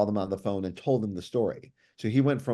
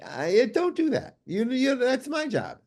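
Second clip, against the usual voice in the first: high, slow, very polite imitation; polite Southern gentleman; 11 semitones higher, slower than usual. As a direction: impersonate a kindly colleague gently declining. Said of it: "with the most polite voice".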